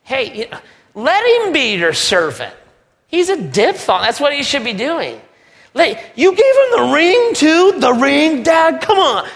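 high, loud, whining protest; whiny older brother. Acted. Older brother whining to his father about the ring. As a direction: whine like a jealous sibling.

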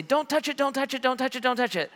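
put-on high-pitched frantic kid warding off a hand; panicked protest. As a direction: squeal it over and over, imitating a scared child. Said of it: "and I would say".

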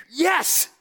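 high, emphatic yes; joyful cheer. Acted. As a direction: cheer the good news like a win. Acts out joyful response to Christmas.

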